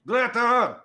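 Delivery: high, loud North Country holler; little high-pitched cook calling out; tiny yelling kitchen voice. Acted. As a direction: yell the order as a tiny voice from the kitchen. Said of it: "this tiny little voice from the kitchen, North Country voice, yelling".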